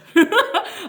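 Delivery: a burst of high, loud laughter; real high laugh. Real, not acted. Laughs at own swimmer claim.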